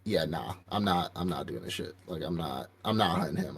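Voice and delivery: low, hushed refusal; hushed, wary big dude refusing; scared refusal. Retold or imitated. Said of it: "the one big dude's like".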